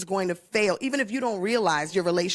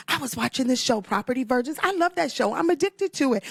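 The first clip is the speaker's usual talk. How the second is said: higher-pitched excited recounting; gushing enthusiasm; 6 semitones higher than usual. Retold or imitated. Host retelling her wish for the show, gushing. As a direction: gush about a favorite show.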